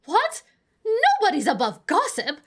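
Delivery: very high, loud squawk on "What?"; indignant old lady, heightened. Acted. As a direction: fire it back fast and incredulous. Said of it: "that was the right inflection, right?".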